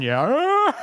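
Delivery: real laughter breaking in; laughing. Real, not acted. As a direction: laugh through the tag line. Laughing at own joke.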